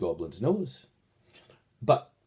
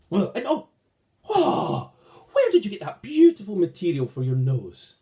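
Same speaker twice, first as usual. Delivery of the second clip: excited envy; 5 semitones higher, 5 dB louder than usual. Acted. Red goblin envies blue's nose.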